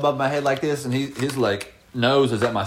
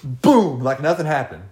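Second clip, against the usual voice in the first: usual speaking voice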